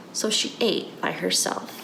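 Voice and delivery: usual speaking voice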